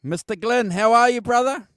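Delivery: high, loud greeting call; calling from far away. Real, not acted. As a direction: call out to someone out of earshot. Calling to a guest not connected.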